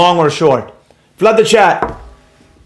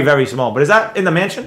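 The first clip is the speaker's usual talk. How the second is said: normal talking voice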